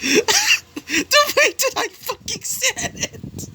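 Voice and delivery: very high, drawn-out squeal of laughter; laughing outburst. Real, not acted. Storyteller breaking character mid-story, helpless high laughing. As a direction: squeak it out through real laughter.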